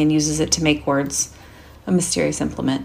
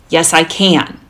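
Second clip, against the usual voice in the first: put-on childish defiance; 8 dB louder, slower than usual. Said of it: "full of childish defiance".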